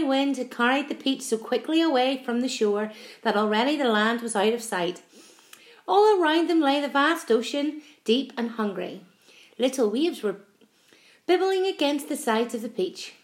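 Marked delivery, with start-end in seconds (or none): none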